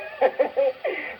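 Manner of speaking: a giggle up high after the joke. Real, not acted. The comic laughs at his own joke: high giggle. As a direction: giggle at your own gag.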